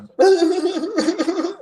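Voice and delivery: high, loud stuttering mock sob; hammed-up wailing cry; imitated bawling. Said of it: "starts bawling".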